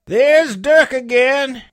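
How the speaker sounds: high, loud, slow call; buccaneer lookout. A narrator's reading. Buccaneer hearing the danger signal, high loud call. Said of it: "said one".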